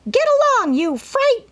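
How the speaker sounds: very high, loud parrot scream; shrill bird insult. A narrator's reading. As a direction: screech it rudely like a parrot. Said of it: "screamed Polly".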